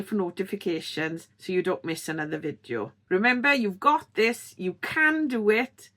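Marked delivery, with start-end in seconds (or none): none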